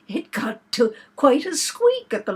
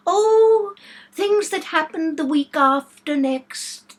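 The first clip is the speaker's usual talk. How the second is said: airy and careless, a bit slower; 8 semitones higher, 7 dB louder, slower than usual. A narrator's reading. Queen brushing off Alice's question, careless and airy. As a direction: toss it off without a care. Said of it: "in a careless tone".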